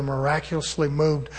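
normal talking voice

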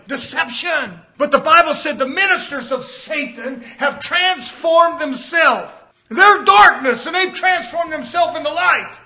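high, loud pulpit shouting; rising sermon climax, shouted; full-force preaching. Acted. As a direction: thunder it from the pulpit. Preaching climax against church.

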